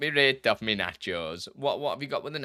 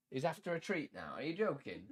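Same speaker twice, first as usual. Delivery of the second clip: usual speaking voice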